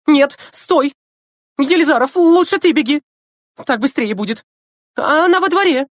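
high, loud, indignant orders; indignant cry. A narrator's reading. Teacher redirects the boys in a high indignant cry. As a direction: keep crying out in outrage. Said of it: "прерывающимся от негодования голосом".

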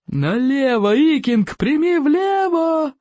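high, stretched bellow of a teacher; roaring command. A narrator's reading. Teacher shouts directions to bolting pupil, high roar. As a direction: roar the order, climbing and drawing out the end. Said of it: "взревел Плевака крикливый".

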